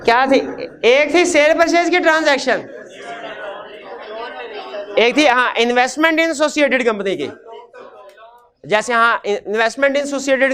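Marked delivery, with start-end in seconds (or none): none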